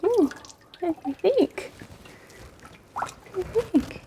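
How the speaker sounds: high soft coo to a puppy. Real, not acted. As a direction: coo gently at the dog. Talking to puppy in bath.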